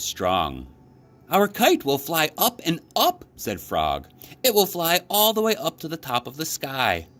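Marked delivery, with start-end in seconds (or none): 1.3-3.2 much higher, eager Frog voice; excited frog full of hope; bright, chirpy storybook Frog; Frog's voice. A narrator's reading. 4.4-7.1 much higher, eager Frog voice; excited frog full of hope; bright, chirpy storybook Frog; Frog's voice. A narrator's reading.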